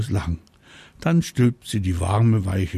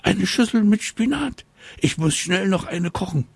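excited mama dragon; 11 semitones higher than usual. Acted.

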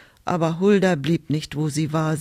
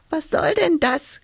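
high-pitched squeaky hedgehog; small, indignant animal voice, pitched way up; annoyed squeak; 10 semitones higher than usual. A narrator's reading. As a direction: squeak it crossly. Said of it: "quietschte da ein Igel ärgerlich".

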